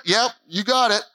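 light, offhand reply; breezy yep. Retold or imitated. Preacher mocking his own casual answer to God, offhand. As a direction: brush it off casually. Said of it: "Like, right at".

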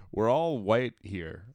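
slow, flat-toned dry remark; deadpan aside. Retold or imitated. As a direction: drop it slowly like a dry aside. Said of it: "Jordan Baker quietly goes".

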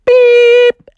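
high, loud sustained beep; long electronic-style tone; imitated oven beep. Demonstrates the oven's signal.

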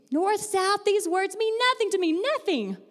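high-pitched, hammed-up frustration; acted exasperation. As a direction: protest in mock despair. Acted cartoon outburst over directions.

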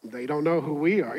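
understated sardonic aside under the breath; low-key retort behind her back; mocking aside. Passengers behind answer the complaining lady among themselves, quietly mocking. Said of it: "and we're all like".

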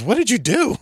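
high-pitched mock shock; retold incredulous cousin; imitated disbelief. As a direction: voice jumps up, imitating an appalled question. Retelling cousin's reaction to the incident.

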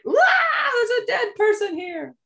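high laughing mock shriek; mock panic. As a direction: shriek it in hammed-up terror. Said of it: "And she's just like".

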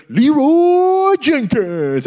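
long drawn-out high yell of the name; battle cry. Retold or imitated. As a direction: stretch the name into a charging war cry. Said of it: "And he goes".

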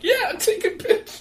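high, slow squeal through laughter; drawn-out gleeful squeal; laughing monkey punchline. Acted. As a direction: crack up while delivering the punchline.

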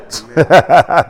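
short laugh. Real, not acted. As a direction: chuckle after a friendly prod. Laughs after urging the congregation.

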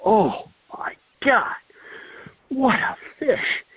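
high, slow, amazed exclamation; mock wonder, drawn out and high; a friend going bonkers over a huge fish, hammed-up awe; imitating excited Jake. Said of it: "just bonkers".

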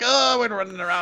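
high cartoonish Scottish brogue; exaggerated Scottish sailor voice; hammy Scottish accent. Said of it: "he's not Popeye and he's not Scottish".

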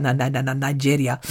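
low, quiet mock Nigerian accent; badly faked Nigerian accent. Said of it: "I don't know why I do Nigerian accent".

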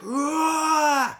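long high-pitched re-enacted holler; adrenaline-charged yell. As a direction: let out a wild adrenaline roar. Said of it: "my adrenaline went from fucking negative".

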